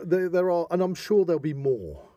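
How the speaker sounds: ordinary talk, nothing marked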